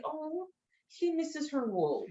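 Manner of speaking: put-on soft sorrowful aside to kids; sympathetic hush. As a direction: murmur it with pity. Sympathy for sad sheep.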